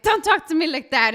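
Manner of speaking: telling him off in a retold fight; firm retort. Retold argument with husband.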